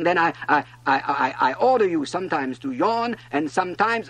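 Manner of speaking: high, loud, stumbling command; vexed sputtering. A narrator's reading. King floundering for an order, sputtering loudly. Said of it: "he sputtered a little and seemed vexed".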